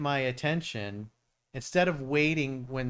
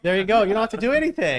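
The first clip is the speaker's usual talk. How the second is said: loud, high, eager encouragement; excited; 9 semitones higher, 11 dB louder, faster than usual. Real, not acted. Host cheering a fan on, loud and high excited. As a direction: cheer him on, much higher and louder.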